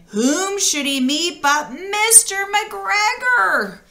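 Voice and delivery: slow, high, suspenseful build; hushed storybook suspense; dramatic reveal. A narrator's reading. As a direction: draw it out for the big reveal.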